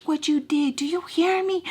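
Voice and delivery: imitated furious shouting, high and strained; screaming mad. Angry man demanding she hear him, screaming. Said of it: "he's, like, screaming. He's so mad".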